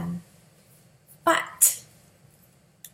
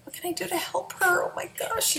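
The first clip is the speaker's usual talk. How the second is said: 1 panicked whisper; 12 semitones higher than usual. Retold or imitated.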